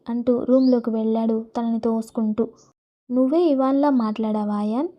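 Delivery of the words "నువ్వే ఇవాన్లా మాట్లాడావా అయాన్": plain reading voice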